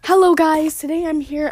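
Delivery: loud, high, peppy greeting; YouTuber intro. Pretend. Kid playing YouTuber intro.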